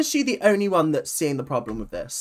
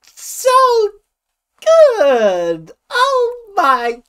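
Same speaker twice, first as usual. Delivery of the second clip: high, loud, drawn-out cooing; gushing; 7 dB louder, much slower than usual. Real, not acted. Reacting to children in a dance video, gushing. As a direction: gush loudly in a high voice.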